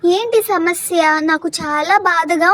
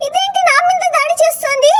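panicked shout; 10 semitones higher than usual. Acted. Grandmother attacked by the possessed girl, panicked shout. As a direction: yell it as if something is lunging at you.

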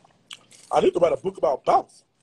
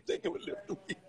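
laughing; 14 dB quieter than usual. Real, not acted. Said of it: "We just say".

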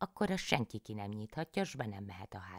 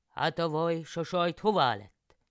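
loud, higher-voiced peasant husband, cheerful; loud, cheery country husband; farmer János; 7 semitones higher, 11 dB louder than usual. A narrator's reading. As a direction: play the hungry husband calling out to his wife. János asks about food.

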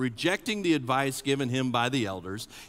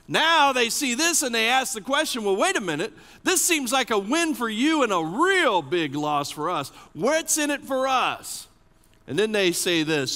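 higher and louder preaching climax; urgent sermon peak; full-force preaching; 9 semitones higher, 5 dB louder than usual. Acted. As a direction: hammer the point to the congregation.

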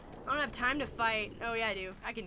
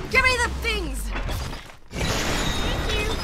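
excited; 9 semitones higher, 4 dB louder, slower than usual. Real, not acted. Greedy grab at loot chest.

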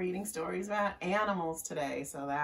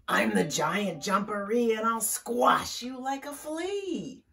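raised, louder boastful threat; 6 semitones higher, 5 dB louder than usual. Acted. Hidden creature threatening Cat, loud showy boast. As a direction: call it out big and menacing in play. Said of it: "she heard a loud voice".

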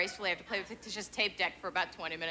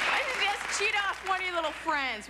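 sweet mom advice; 10 semitones higher than usual. Retold or imitated. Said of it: "I'll be like".

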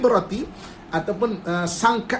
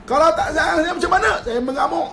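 higher, laughing mock threat from the wife; 6 semitones higher than usual. Wife jokingly threatens her husband she will rage.

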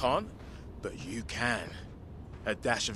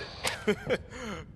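laugh; 7 semitones higher, 5 dB quieter than usual. Real, not acted. Laughs about being infected.

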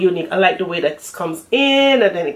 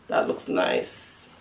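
whispered aside; 12 semitones lower, 8 dB quieter, slower than usual. Real, not acted. Admiring under breath.

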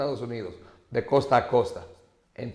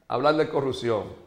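formal political speech; 5 semitones lower than usual. Acted. Host delivering a campaign speech for Danilo Medina. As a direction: speak like an orator at a rally.

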